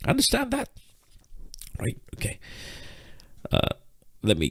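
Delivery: breathless and panting; out of breath. Real, not acted. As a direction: talk while catching your breath. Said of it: "while I catch my breath".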